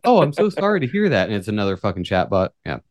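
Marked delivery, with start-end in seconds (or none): 0.0-1.3 higher-pitched customer service apology; sympathetic manager. Acted. Manager who is really another chatbot, mock-sympathetic. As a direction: parody a scripted, polite apology. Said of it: "the manager's like".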